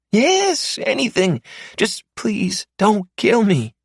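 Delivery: high, panicked pleading voice, fast and loud; terrified captive man. Acted. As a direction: beg for your life in a frantic high voice. Mark pleads again.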